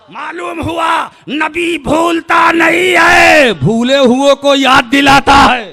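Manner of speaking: high, loud, slowed preacher's cry; impassioned sermon shout; fervent preaching. Acted. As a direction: proclaim each phrase to the crowd. Preaching at full force.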